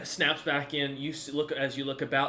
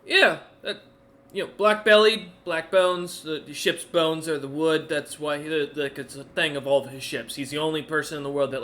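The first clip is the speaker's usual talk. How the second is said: urgent explaining; 3 semitones higher, 8 dB louder than usual. Acted. Bard urgently explains the ships' owner.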